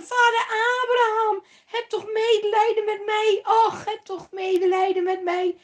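loud, high, begging voice; desperate high loud plea from the fire; rich man pleading. A narrator's reading. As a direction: beg for one drop of water. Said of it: "riep de rijke man".

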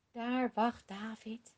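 usual speaking voice

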